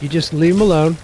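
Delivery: ordinary delivery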